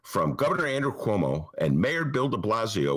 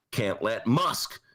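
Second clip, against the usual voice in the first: normal talking voice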